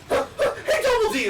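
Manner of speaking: high shouted cartoon kid; Eddy voice. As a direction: yell it like a brash cartoon kid. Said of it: "it's like Eddie".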